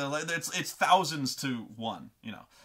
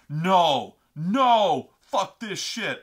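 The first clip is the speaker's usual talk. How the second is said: loud, indignant imitation; hammed-up angry protest; pissed off; 4 semitones higher, 9 dB louder, much slower than usual. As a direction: imitate a brother shouting in refusal. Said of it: "He was so fucking pissed".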